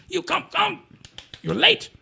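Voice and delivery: high, pushy old man, lightly voiced; intense old boss. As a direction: bark orders like a brisk old headmaster. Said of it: "seventy-five-year-old intense Iron Man".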